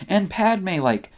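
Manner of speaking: ordinary delivery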